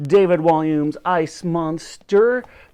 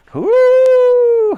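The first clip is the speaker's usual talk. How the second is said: very high, loud trumpeting hoot; Wooly the mammoth joins in with a high loud hoot; 11 dB louder than usual. A narrator's reading. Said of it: "hooted Wooly".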